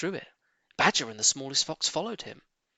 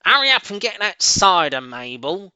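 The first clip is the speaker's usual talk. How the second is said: loud call from upstairs; 7 semitones higher, 10 dB louder than usual. A narrator's reading. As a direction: call out across the house. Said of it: "the voice called".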